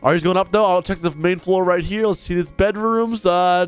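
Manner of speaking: usual speaking voice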